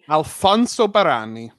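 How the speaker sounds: Italian accent. Shown as a demonstration. Co-host supplying the inventor's name, Italian accent.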